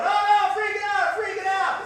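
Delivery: a cartoon fright as everyone swaps spots; mock panic. Shown as a demonstration. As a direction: scramble and wail in play panic. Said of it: "by going".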